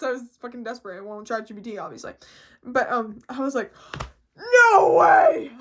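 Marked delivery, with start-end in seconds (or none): 4.4-5.5 high, very loud squeal of disbelief; ecstatic, drawn-out yell; excited shout. Retold or imitated. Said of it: "I was so excited".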